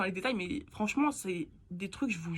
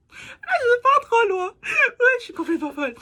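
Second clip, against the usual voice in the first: loud, high squeal through laughter; hysterical self-mocking laughter; 13 semitones higher, 10 dB louder than usual. Real, not acted. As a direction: crack up at your own craziness. Cracking up at herself.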